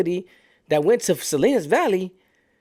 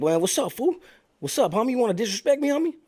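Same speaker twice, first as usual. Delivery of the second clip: high, quieter mock challenge; retold challenge; 7 semitones higher, 4 dB quieter than usual. One inmate confronts another over disrespect, retold challenge. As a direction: imitate someone picking a fight, pitched up.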